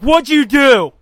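loud, accusing question; imitating a mom who expects trouble; demanding mom. Mom to her son, loud demanding question. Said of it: "my mom's like".

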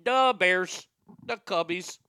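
put-on Chicago sports fan accent; hammed-up Chicago accent. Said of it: "does a great Chicago accent".

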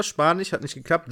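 ordinary talk, nothing marked

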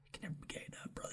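breathy teasing whisper. Acted. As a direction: whisper it like a secret joke. Teasing joke to co-host.

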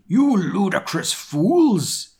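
high, drawn-out theatrical shout; hammed-up outraged headmaster; angry shout. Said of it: "He kept shouting at us angrily".

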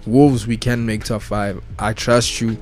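ordinary delivery

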